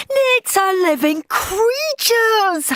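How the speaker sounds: high, slow howl of protest; howling boy. A narrator's reading. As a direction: howl it like a wronged kid. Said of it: "howled Henry".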